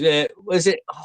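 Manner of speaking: normal talking voice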